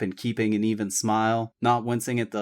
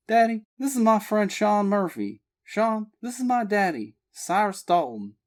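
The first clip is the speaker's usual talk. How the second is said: lightly higher, eager daughter; Abby voiced; 8 semitones higher than usual. A narrator's reading. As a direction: introduce him warmly. Abby introducing friend.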